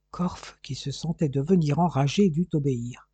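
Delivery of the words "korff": ordinary delivery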